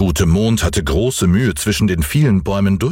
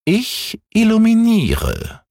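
high, slow, drawn-out cat voice; little cat calling down; 13 semitones higher, slower than usual. A narrator's reading. Cat in a tree to the boy, high slow call. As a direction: stretch it out high, as a small cat. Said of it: "rief die kleine Katze herunter".

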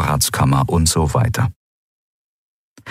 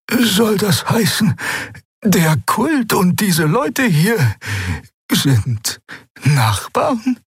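high, slow, astonished voice; high-pitched surprised old woman; high curious old healer; 12 semitones higher, much slower than usual. A narrator's reading. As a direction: ask it high and disbelieving.

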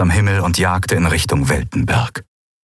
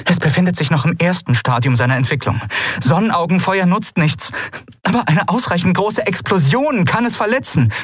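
high, pressing voice, growing higher; urgent; 9 semitones higher than usual. A narrator's reading. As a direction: warn them with real urgency. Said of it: "Ihre Stimme hatte nichts von ihrer Dringlichkeit verloren". (dringlich, mit hoher Stimme)

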